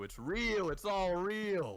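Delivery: triumphant told-you-so; excited insistence. Real, not acted. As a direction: hammer it home with glee. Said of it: "I fucking told you".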